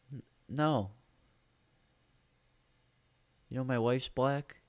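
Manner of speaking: a hint of an indignant comedian; Bill Burr voice. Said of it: "Bill Burr saying to me".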